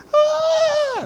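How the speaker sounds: sudden very high, loud scream; long mock shriek of jubilation; demonstrated jubilant scream. Shown as a demonstration. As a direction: let out a full-voiced whoop for show.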